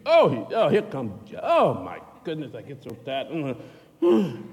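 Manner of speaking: high, loud exasperated groaning; mock complaining. People griping about the salty man, mock exasperation. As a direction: whine like someone fed up. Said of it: "They're always complaining against you".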